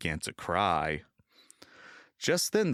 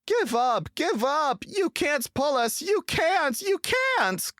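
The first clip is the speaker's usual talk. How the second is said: much higher, loud taunting chant; jeering trucks; 4 dB louder, slower than usual. A narrator's reading. Trucks jeering at James on the line, loud. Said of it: "called the trucks".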